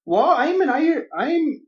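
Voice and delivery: high, slow Irish lilt; Irish accent. Shown as a demonstration. Asked for the sentence in Irish, high Irish accent. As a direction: start high in a put-on Irish brogue, then break off. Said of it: "I want you to say".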